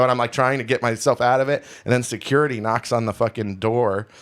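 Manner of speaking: ordinary talk, nothing marked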